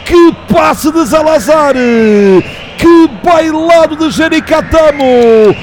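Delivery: screaming goal celebration, high and loud; explosive goal commentary. Real, not acted. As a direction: yell it out in pure joy.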